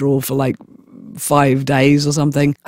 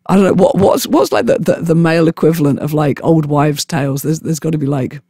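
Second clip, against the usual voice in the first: ordinary talk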